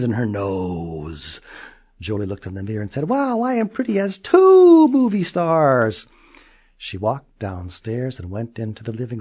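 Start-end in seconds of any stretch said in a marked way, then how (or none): 3.1-6.1 high, loud admiring voice; vain girl. Acted. Julie admiring herself in the mirror, high and loud. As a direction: preen at yourself.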